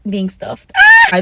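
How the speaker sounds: sudden high scream of embarrassment after "being stuffed"; embarrassed shriek. Real, not acted. Vlogger shrieking at what she just admitted to viewers. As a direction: let out a shrill squeal at her own words.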